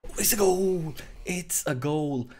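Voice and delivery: loud, high cry of celebration; excited goal shout. Real, not acted. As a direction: call the goal out loud, stretching the words. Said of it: "I screamed extremely loud there".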